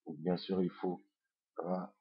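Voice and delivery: usual speaking voice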